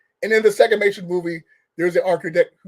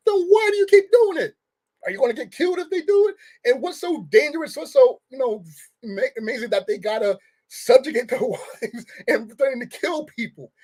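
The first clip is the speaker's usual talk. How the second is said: much higher, disbelieving questions; baffled, demanding an answer; incredulous; 6 semitones higher than usual. Real, not acted.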